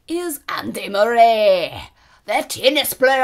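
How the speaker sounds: loud, hammed-up Scottish accent; bad Scottish accent. Shown as a demonstration. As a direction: belt it out in a shaky attempt at a Scottish accent. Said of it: "I can't do a Scottish accent".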